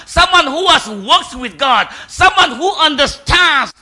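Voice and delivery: loud, high, driving preacher's cadence; full-force preaching. Real, not acted. Preacher stirring the congregation about sensing God, impassioned and loud. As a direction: build each repeated phrase with rising force.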